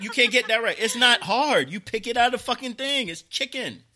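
high, loud mock scolding; retold exasperation. Customer scolding the KFC staff, high and exasperated. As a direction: scold them in disbelief. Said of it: "I was like".

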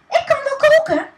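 very high, loud shriek; high loud mock shriek. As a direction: squeal it out eagerly. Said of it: "gilde de vrouw van de burgemeester".

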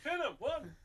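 loud mock referee counting. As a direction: call the pin like a ref.

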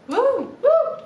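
high whooping cries; Andy Kaufman homage. Pretend. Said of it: "an homage to Andy Kaufman".

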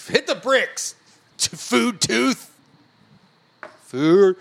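high, drawn-out teasing; mocking singsong. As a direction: taunt him in a singsong. Teasing about food tooth.

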